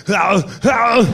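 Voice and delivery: drawn-out mock groan; two long, put-on howls; imitated Arnold grunt. Calling out to his friend on the phone, mock groaning. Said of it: "And Arnold heard me".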